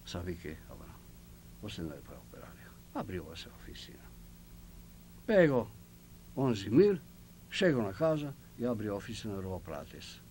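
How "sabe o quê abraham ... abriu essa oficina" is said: hushed aside. Retold or imitated. Confiding private advice.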